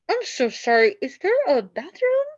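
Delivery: high, strained polite apology; polite sick guest. Acted. Guest about to be sick asking for a bathroom, high and polite. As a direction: hold it in politely. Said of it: "politely cups her hand".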